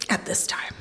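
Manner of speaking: a breathless deadpan whisper; whispered aside. Acted. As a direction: drop to a soft whisper for the punchline. Joking aside about new friends.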